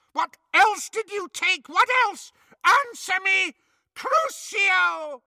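high, shrill-pitched furious witch screech; shrieking witch. Acted. Bellatrix shrieks at captive Hermione, furious high witch screech. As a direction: screech it like a cruel torturer.

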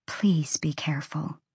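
hushed, unvoiced plea; whispering. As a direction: whisper it like a worried mother. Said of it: "Ember whispered".

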